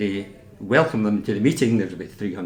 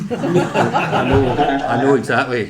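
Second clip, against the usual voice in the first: laughing; 3 semitones higher, 7 dB louder, slower than usual. Real, not acted. Laughing at own joke.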